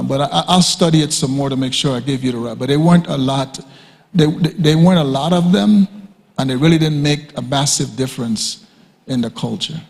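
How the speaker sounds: usual speaking voice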